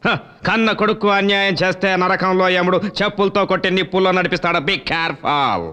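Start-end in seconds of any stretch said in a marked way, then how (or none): 4.6-5.6 slow warning. Acted. Son threatening his old father.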